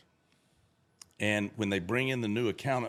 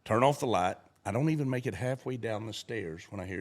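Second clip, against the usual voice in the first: usual speaking voice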